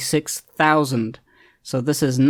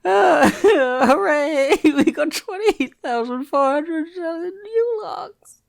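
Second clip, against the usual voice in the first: slow, very high squeal through laughter; laughing uncontrollably; 14 semitones higher, much slower than usual. Real, not acted. Gamer celebrating twenty-eight thousand logs, laughing helplessly. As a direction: cheer while helplessly cracking up. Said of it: "I don't know why I'm laughing".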